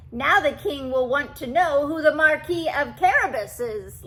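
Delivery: a sly cat delighted with its trick; laughing cat. A narrator's reading. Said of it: "laughed the cat".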